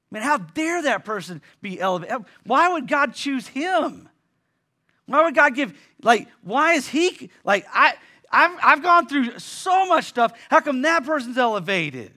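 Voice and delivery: high, loud, aggrieved outcry; indignant complaint. Retold or imitated. Pastor voicing a jealous believer's outrage at God, high and loud. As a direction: throw it up as a wounded protest.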